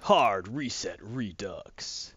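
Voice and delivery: very slow, low title reveal; announcer title read. Real, not acted. Host presenting the second game, slow low announcer voice. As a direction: draw out each word like an announcer.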